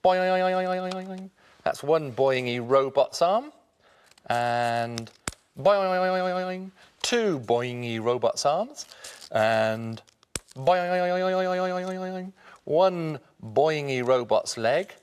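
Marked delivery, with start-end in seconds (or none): 0.0-1.3 stretched springy boing; boing sound. Pretend. Springy paper arm sound. 5.6-6.7 stretched springy boing; boing sound. Pretend. Springy paper arm sound. 10.5-12.3 stretched springy boing; boing sound. Pretend. Springy paper arm sound.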